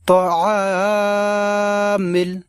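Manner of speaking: তাআমিল with the আ held long and slow; slow teaching drawl on তাআমিল; long drawn vowel. Shown as a demonstration. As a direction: stretch the আ in তাআমিল. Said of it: "লম্বা উচ্চারণে টান দেব এইভাবে".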